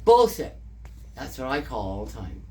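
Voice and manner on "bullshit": loud, high mock yell; retold scream. Retelling a man screaming in the street, imitated yell. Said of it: "just screaming".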